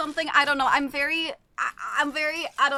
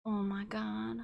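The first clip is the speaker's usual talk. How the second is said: hushed anticipation; 4 semitones lower, 11 dB quieter than usual. Real, not acted. About to taste crackers.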